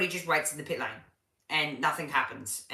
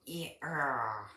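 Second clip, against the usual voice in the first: normal talking voice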